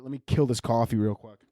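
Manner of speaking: ordinary talk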